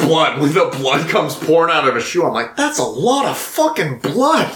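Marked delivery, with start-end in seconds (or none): 2.6-4.5 high, astonished aside; mock shock. Podcaster reacting to the bloody shoe, high astonished mock. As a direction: say it wide-eyed in disbelief.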